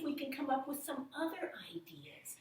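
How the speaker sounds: whispered trailing phrase. Real, not acted. Teacher whispering to kids about new ideas, whispered. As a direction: drop to a whisper.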